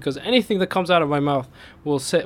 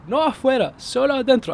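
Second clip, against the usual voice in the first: much higher, desperate repeating; frantic pleading; 12 semitones higher than usual. Retold or imitated. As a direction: insist again in rising panic. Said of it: "I said".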